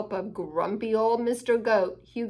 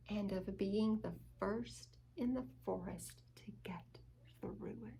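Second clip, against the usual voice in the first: whisper; 2 semitones lower, 16 dB quieter than usual. A narrator's reading. Bedtime story, secret big dream.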